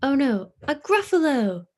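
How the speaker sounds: high, loud alarmed cry; fox crying out. Acted. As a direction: shriek it like a startled fox. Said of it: "cried the fox".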